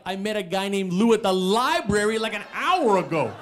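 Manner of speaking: imitated insistent roommate; roommate's insistent protest. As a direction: plead your case through the door. Roommate explains through door.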